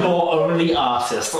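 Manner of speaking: slow, sneering mock put-down; condescending. Teen mimicking the guide mocking Americans, slow condescension. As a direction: lean on it slowly to belittle them. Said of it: "she's so condescending".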